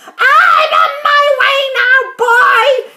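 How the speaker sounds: high, loud crone's call; calling down loudly. A narrator's reading. Grandma calls down to George from above, loud. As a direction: call down like a gleeful old grandma. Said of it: "she called down to George".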